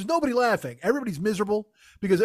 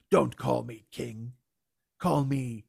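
low, slow, softer mock-grand king; hammed-up undersea ruler, low and slow; pompous shark king; 9 semitones lower, 5 dB quieter, much slower than usual. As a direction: play the villain king with slow gravity.

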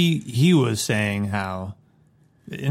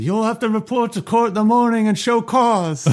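much higher, prim legal voice; mock Hagen lawyer voice; 11 semitones higher than usual. Host voices Hagen threatening the Godfather, mock prim lawyer voice. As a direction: play Hagen as a fussy lawyer.

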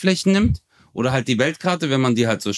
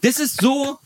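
higher, mock-outraged Turkish rant; mock indignant Turkish guy; 6 semitones higher than usual. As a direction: play up the offended Turkish friend. Mock rant about German mispronunciation.

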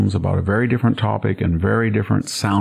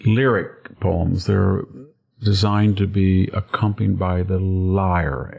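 usual speaking voice

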